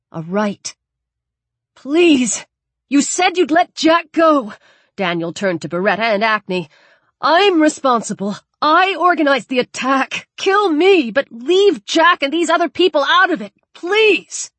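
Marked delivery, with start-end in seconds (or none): 1.7-4.5 desperate pleading. Acted. Prisoner begging for boy's life. 7.2-14.5 high, loud begging cry; desperate pleading. Acted. Man begging captors to spare others.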